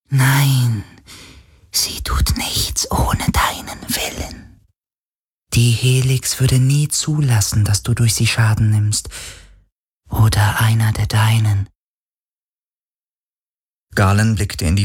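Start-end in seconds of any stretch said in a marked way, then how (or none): 0.1-11.7 geisterhaftes Flüstern. A narrator's reading. Geist spricht weiter flüsternd.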